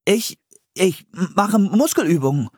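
high, halting, flustered answer; hesitant stammer. A narrator's reading. Philipp answers Priscilla's surprised question, stammering. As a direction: stumble over the first words, pitched high.

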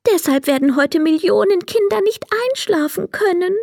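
high, small, regretful voice; sorrowful little fairy. A narrator's reading. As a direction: confess helplessness in a tiny high voice. Tearful fairy admits her failure.

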